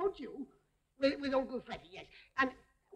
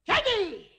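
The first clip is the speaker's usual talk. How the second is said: loud, high call of the name; scolding shout; 9 semitones higher, 8 dB louder than usual. Acted. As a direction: shout it at a naughty little one. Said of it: "I won't shout anymore".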